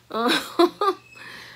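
short bright giggle rising; high giggle. Real, not acted. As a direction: laugh it off lightly. Laugh after confiding aside.

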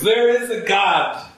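high, slow, wondering declaration; elated announcement. Retold or imitated. Mother telling her kids with high, slow awe. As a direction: announce it like a revelation, lifted high.

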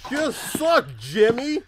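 high, loud scolding rant; angry ranting. Real, not acted. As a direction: berate him loudly, exasperated. Berating monster after death.

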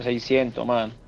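ordinary talk

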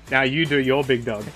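usual speaking voice

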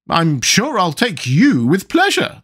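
high, slow queen's voice, pleased. A narrator's reading.

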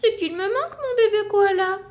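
high, plaintive cry; hammed-up longing; missing a friend. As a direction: lament for the missing koala. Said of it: "fait tout à coup Alistair".

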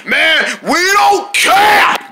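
high, drawn-out shout; defiant yell. Acted. Jungle dismisses the warning, high drawn-out defiant yell. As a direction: bellow it, stretching the words. Defiant outburst about going out.

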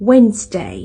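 "Wednesday" as WENZ-day; "Wednesday" with first-syllable stress, falling; English Wednesday. Shown as a demonstration. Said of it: "ก็บ่แม่น Wednesday".

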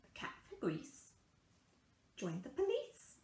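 ordinary delivery

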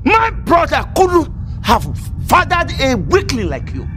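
high, contemptuous insult; heated, indignant scorn; scornful outburst. Acted. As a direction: hurl the insult at a high pitch.

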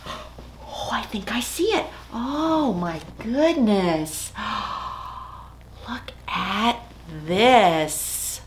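unvoiced excited whisper; breathless whisper of discovery. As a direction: whisper it like a secret find.

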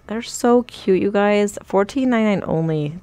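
normal talking voice

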